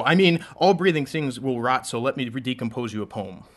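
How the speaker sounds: rhythmic, building slam delivery; spoken word poem. Acted. Poet reciting a love poem to a crowd, spoken word. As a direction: perform a love poem on stage.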